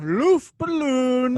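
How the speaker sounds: squeaky high balloon word; helium voice. Pretend.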